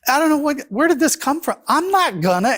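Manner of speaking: high-pitched mock protest; imitated upset yelling. Fiancée refusing to go along with his conversion, mock yelling. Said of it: "she started yelling and s-, getting upset".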